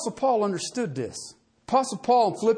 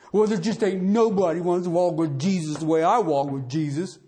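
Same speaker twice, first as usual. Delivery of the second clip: mock a holier-than-thou believer; smug self-righteous voice; 4 semitones lower than usual. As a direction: lower voice, smug pious boast. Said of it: "we get that way".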